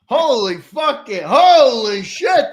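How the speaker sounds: loud, high, drawn-out amazement; acted-out whooping disbelief; astonished hype. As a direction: stretch the swear words out in awe. Retells reaction to hearing first shows.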